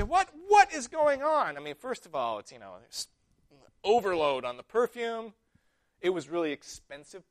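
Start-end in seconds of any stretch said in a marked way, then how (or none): 0.0-1.6 higher, louder, slow indignant disbelief; mock outrage. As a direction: throw up your hands in mock shock. Disciples shocked by the perfume.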